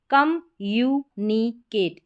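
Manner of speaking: Communicate slowly in four pieces, kam-yu-ni-kate; slow, spaced-out communicate; syllable-by-syllable reading. Shown as a demonstration. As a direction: break communicate into separate syllables for a learner. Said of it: "इस तरीके से".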